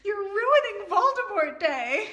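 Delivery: high, loud, slow giggly repeat; laughing quote. Real, not acted. Reader gleefully repeating her favourite line. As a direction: savor the line through laughter. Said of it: "my new favorite quote".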